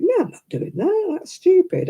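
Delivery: high, dismissive self-talk; scornful inner voice. Retold or imitated. Knitter scoffing at the pattern, high and dismissive. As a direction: wave the pattern off as stupid. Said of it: "I think".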